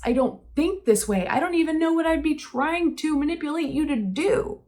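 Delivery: distressed pleading, pitched high; high, insistent imploring; begging. Retold or imitated. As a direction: protest desperately as you re-enact the plea. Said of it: "I kept begging the both of them".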